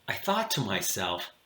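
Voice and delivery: plain reading voice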